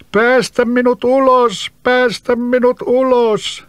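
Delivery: high, slow hollow plea from underground; eerie trapped-spirit voice pleading slowly. Acted. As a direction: call up muffled from beneath the roots. Spirit begs again.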